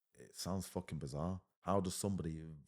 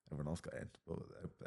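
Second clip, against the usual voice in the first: ordinary talk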